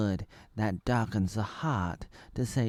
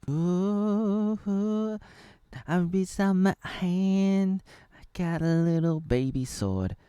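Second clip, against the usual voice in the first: high, slow dreamy voice; lightly voiced whimsical character; high playful character; 7 semitones higher, slower than usual. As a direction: croon it like a cartoon heroine. Character voice in play.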